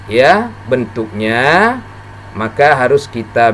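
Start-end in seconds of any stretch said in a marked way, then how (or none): none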